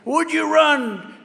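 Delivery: hammed-up yell of a hounding press pack; mock screaming reporters. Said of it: "these maniacs are screaming".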